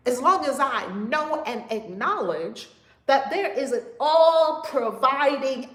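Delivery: slow, loud, high preaching that builds; building preaching climax. Acted.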